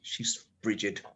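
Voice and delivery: low, soft, slow confession; confiding low. Acted. Husband confiding about his wife, low soft slow. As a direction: drop your voice to confide it.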